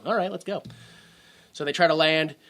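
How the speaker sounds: normal talking voice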